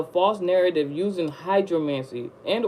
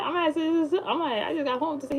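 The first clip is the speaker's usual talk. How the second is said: flustered pleading; 10 semitones higher than usual. Retold or imitated. Retelling the confused phone call.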